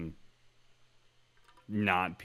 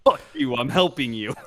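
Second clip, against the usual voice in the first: loud mock-furious outburst; mock angry; 9 dB louder than usual. Co-host voicing the arguing parents, mock angry. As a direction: burst out in comic exasperation.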